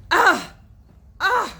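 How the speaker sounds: high, loud repeated groans. A narrator's reading. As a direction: groan dramatically twice. Tom groaning on his back.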